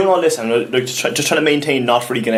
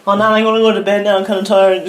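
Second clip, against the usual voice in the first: faking casual; 8 semitones higher, 4 dB louder than usual. Retold or imitated. Excuse to nan while high.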